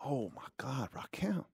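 hushed, awed whisper; starstruck whisper. Retold or imitated. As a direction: breathe the name in awe. Starstruck seeing [name].

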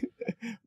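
high breathy giggle, almost silent; a quick high little laugh. Real, not acted. Host giggling at his own rambling, short high laugh.

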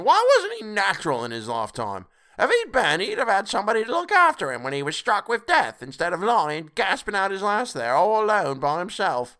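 high, loud, indignant charwoman; scornful charwoman. A narrator's reading. Charwoman blaming the dead man for dying alone, scornful.

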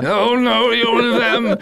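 high, loud frightened villain voice, cracking into a laugh; panicked Hans. Retold or imitated. Imitating Hans pleading with the hostages in high panic. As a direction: squeal it like a cornered coward. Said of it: "It almost sounds like John Hurt".

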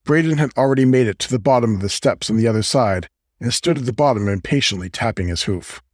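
ordinary delivery

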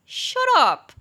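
sweetly high request snapping into a loud "shut up"; joking telling-off. Acted. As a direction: playfully shut a teasing friend up. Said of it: "我就会笑笑的，然后把嘴巴凑到他耳边". (笑着开玩笑叫朋友闭嘴)

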